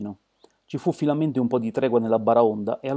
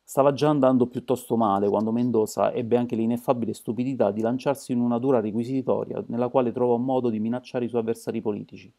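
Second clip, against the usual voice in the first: ordinary delivery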